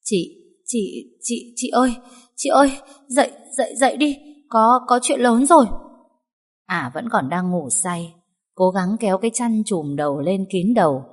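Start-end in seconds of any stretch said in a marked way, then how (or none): 0.0-5.6 high, halting stammered call; stammering. A narrator's reading. Maid waking her sleeping mistress with big news, stammering. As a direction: stammer urgently to wake the sleeper. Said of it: "lắp bắp gọi".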